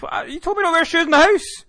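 high, loud indignant outburst; exasperated yell. Retold or imitated. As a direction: blurt it out in disbelief at the unfair rule. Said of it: "And he's like".

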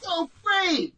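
high, drawn-out burst of delight; gushing. Real, not acted. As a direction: squeal it slowly with fan joy. Gushing over the main event.

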